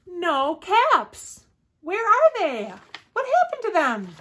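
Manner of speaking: high, loud cry of dismay; alarmed searching. A narrator's reading. Peddler hunting for his missing caps, alarmed.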